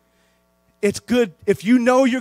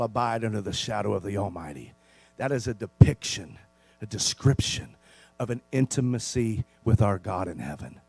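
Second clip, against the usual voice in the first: hushed pastoral appeal; 9 semitones lower, 5 dB quieter than usual. Acted. Pastor pleading softly with his flock to get close to God. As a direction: draw the congregation close, gently.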